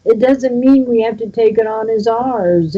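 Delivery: ordinary delivery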